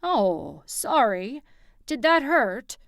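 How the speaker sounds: fake-sorry tease; mocking his brother with fake concern; mock apology. A narrator's reading. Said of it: "by the tone of his voice".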